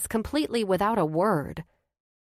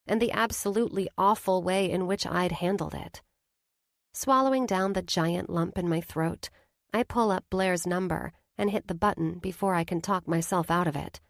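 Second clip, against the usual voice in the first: ordinary delivery, nothing marked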